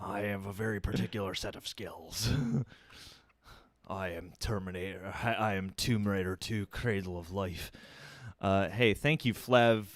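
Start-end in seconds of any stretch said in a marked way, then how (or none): 0.0-2.3 low, soft put-on menace; Liam Neeson. Host impersonating named action star, low quiet menace. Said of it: "Liam Neeson and Pierce Brosnan". 3.9-7.7 low, softer put-on title announcement; mock movie-star voice. Host running through film-title gag, low actor voice. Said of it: "Gerard Butler all to do".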